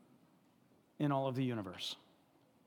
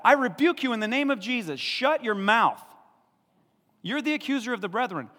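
higher, louder commanding rebuke; forceful rebuke; 10 semitones higher, 8 dB louder than usual. Shown as a demonstration. Preacher shows how to rebuke the devil, loud command. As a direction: command the accuser to shut up. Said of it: "You speak to that".

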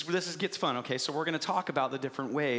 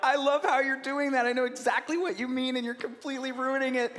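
higher, gushing praise; delighted parent; 7 semitones higher than usual. Retold or imitated. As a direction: gush over the baby's botched words. Parent delighted by baby's wrong words.

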